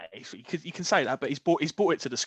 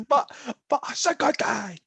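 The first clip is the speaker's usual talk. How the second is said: higher, slow mock protest; put-on protest; 5 semitones higher than usual. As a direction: put on an indignant woman, drawing it out. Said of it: "She goes".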